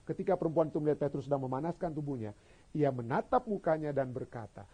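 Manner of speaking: ordinary delivery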